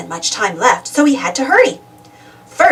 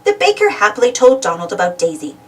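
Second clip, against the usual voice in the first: ordinary delivery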